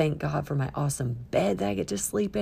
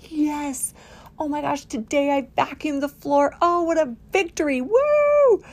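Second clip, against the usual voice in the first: high, giddy victory cry; celebratory cheer; 10 semitones higher, 4 dB louder than usual. Acted. Someone celebrating a freshly vacuumed floor, mock-triumphant cheer. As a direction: build to a very high, loud whoop. Acting out celebrating small wins.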